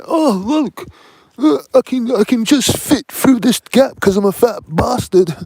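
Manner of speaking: loud, high-pitched mock yelling; hammed-up high shout. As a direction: bellow it like noisy sightseers, mockingly. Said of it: "The people shout".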